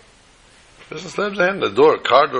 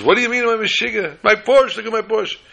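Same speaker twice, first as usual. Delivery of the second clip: high-pitched hammed-up indignation; mock screaming outrage; 10 semitones higher than usual. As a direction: wail mildly about your wrecked car, voice pitched way up. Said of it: "she's screaming and yelling".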